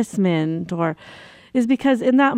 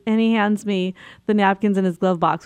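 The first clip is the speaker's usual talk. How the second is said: usual speaking voice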